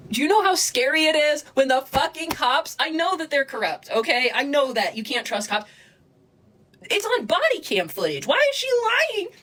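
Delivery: high-pitched, real exasperated rant; distressed outburst. Real, not acted. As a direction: protest in disbelief, climbing to a high wail. Distressed outburst about lying police.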